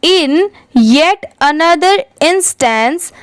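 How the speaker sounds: each word read out slowly and clearly; slow model reading. Shown as a demonstration. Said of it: "अच्छे से read करके देखते हैं".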